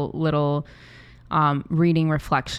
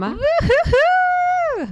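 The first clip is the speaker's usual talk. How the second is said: high slow woo-hoo whoop; high cheering whoop; 6 dB louder, much slower than usual. Real, not acted. Cheering the website plug.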